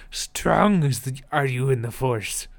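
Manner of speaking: creature impression on the edge of laughter; Yoda. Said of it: "saying in his Yoda voice".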